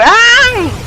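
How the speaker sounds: sudden high panicked scream. Real, not acted. Scream while driving boat.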